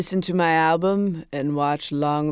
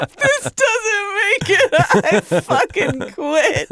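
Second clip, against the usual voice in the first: high, slow, hammed-up lament; mock despairing whine; 13 semitones higher, slower than usual. Comedian threatening to quit over her album, mock despairing whine. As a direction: draw it out high, breaking into laughter.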